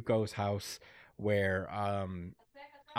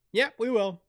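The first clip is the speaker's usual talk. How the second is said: much higher, cheerful voice; reply brightly, pitched up; high polite reply; 10 semitones higher than usual. Retold or imitated. Asa voiced to neighbor.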